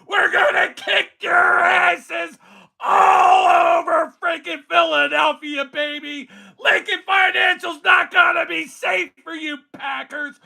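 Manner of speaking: crank it up and hype the crowd; hype shout. Acted. Fan streaming to chat, hype shout. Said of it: "You ain't got this energy".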